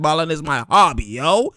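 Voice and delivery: ordinary talk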